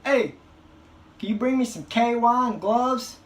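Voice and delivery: loud, high call down the hall; doctor yelling for the nurse, no shame; yelled out the door. Retold or imitated. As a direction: shout it for everyone to hear. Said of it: "he just yells this out the door".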